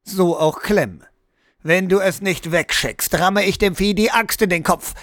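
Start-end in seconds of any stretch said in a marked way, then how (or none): none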